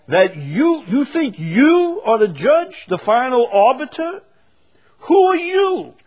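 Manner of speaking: loud, very high incredulous rebuke; emphatic challenge. Acted. Preacher confronts those who judge their brother, loud emphatic challenge. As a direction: call them out at full pitch and volume. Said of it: "being emphatic, the idea is".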